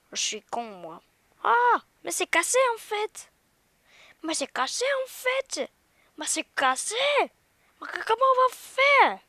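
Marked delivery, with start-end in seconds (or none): 1.4-3.3 high shouting in disbelief; shocked shout. Real, not acted. Freaking out at broken game. 4.2-7.0 loud, high exasperation; exasperated shout. Real, not acted. Repeating game is broken. 7.2-9.2 very high panicked yelling. Real, not acted. Panicking in the game.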